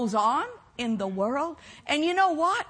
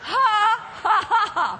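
higher, deliberate ha-ha burst; practised laugh; 5 semitones higher, faster than usual. Shown as a demonstration. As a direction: lead the room in forced hearty laughter. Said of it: "Can you practice with me?".

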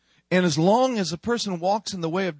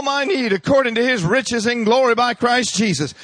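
defiant declaration; 5 semitones higher than usual. Acted. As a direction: stand firm and proclaim it. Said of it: "Start talking down to him".

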